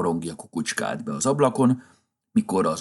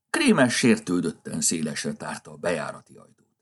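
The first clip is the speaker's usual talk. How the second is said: plain reading voice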